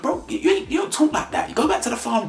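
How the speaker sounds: very high, mocking scold. Real, not acted.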